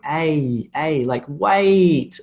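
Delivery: drawn-out A gliding to EE, as in WAIT; combined vowel sound. Shown as a demonstration. Teacher illustrating combined vowels, stretched gliding demo. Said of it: "two vowels combined".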